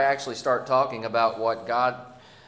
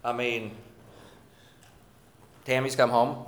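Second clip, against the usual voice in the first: ordinary talk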